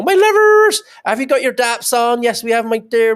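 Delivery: high, loud broad Bristol West Country voice; Bristol accent. Retold or imitated. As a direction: put on a thick Bristol accent, calling out fondly. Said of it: "that's how they talk in Bristol".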